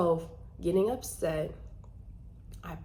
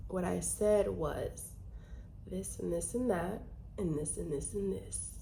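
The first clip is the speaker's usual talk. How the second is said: forced calm; 11 dB quieter than usual. Shown as a demonstration. Daughter patiently answering mom, softly. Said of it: "I'll take a deep breath".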